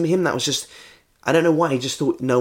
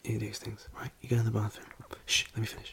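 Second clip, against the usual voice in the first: near-whisper, very soft; hushed insistence; 2 semitones lower, 14 dB quieter, faster than usual. Acted. Shushing Jeffrey to finish bad news, hushed. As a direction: quietly press on past his interruption.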